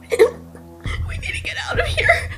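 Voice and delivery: high, tearful, frantic whisper rising to a cry; panicked crying. Acted. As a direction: sob out the warning in terror. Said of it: "starts breaking down like she's going mad".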